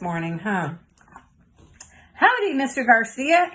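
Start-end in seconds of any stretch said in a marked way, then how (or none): 2.1-3.5 higher, louder greeting; yelled greeting. A narrator's reading. Girl yelling hello to her teacher, higher and louder. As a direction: yell it across the classroom. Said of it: "Annie yelled".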